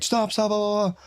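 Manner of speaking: high, panicky pleading; panicked kid. Retold or imitated. Friend begging him to stop choking the kid, panicked. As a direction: beg him to stop, voice jumping up. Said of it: "the kid I knew was like".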